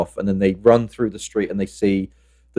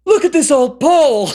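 giddy enthusiasm; 7 dB louder than usual. Acted. Ray loves this old place, gushing at high pitch. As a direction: burst out like an overexcited kid. Acting Ray delighted with firehouse.

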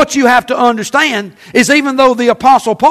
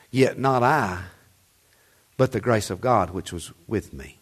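much lower and much softer, almost confiding; hushed reverent read; 13 semitones lower, 11 dB quieter than usual. Acted. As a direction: drop to a hush on the grace of God. Preacher drops to hushed reading.

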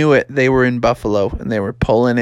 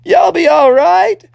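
high, loud cheery call; pitched-up, hammed-up encouragement; encouraging frog dad; 5 dB louder than usual. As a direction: reassure your son from far below. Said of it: "his dad was like".

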